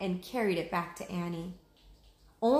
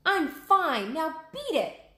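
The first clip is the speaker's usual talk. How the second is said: higher, louder scolding; defiant Annie; 9 semitones higher, 5 dB louder, slower than usual. Acted. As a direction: shoo the bird away in defiance. Annie shakes fist at vulture.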